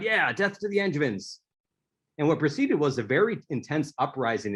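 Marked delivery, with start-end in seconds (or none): 0.0-1.4 higher-pitched mock battle cry, not shouted; lightly acted rebel cheer; retold crowd's cry. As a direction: imitate a rallying crowd, voice pitched up. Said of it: "took out their blades and cried".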